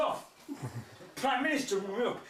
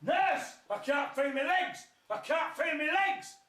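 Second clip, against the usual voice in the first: panicking patient calling the nurse; screaming for the nurse; 8 semitones higher, slower than usual. Retold or imitated. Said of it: "screaming his head off".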